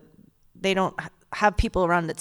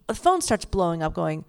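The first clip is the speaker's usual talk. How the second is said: usual speaking voice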